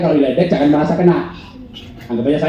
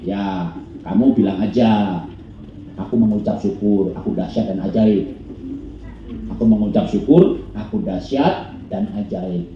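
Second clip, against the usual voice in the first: calm fatherly advice; 9 semitones lower, 5 dB quieter than usual. Retold or imitated. Father comforting his bullied son, soft and low. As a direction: gently coach your son what to say. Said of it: "saya bilangin begini".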